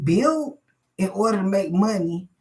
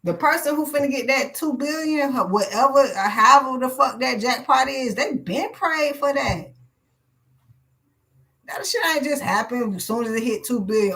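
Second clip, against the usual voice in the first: usual speaking voice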